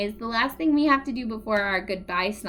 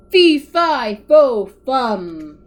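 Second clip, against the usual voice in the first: big booming giant call, much louder; the giant calling out over the web, booming; 2 semitones higher, 12 dB louder, much slower than usual. Acted. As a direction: boom it like a giant from above. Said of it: "A loud booming voice called out".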